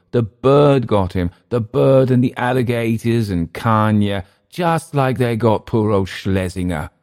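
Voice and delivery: sneering mimicry of Emile; mocking Emile. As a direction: ham up his gossip.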